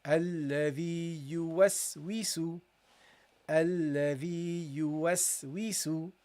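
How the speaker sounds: الذي يوسوس read slowly and joined, with a long EE in الذي; slow model reading. Shown as a demonstration. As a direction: sound out الذي يوسوس slowly in connected syllables. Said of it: "that's how you read this".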